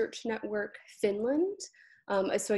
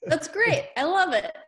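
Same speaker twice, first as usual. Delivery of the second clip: laughing, higher and louder; bursting with delight; real amused delight; 9 semitones higher, 6 dB louder than usual. Real, not acted. Delighted reaction to the numbers.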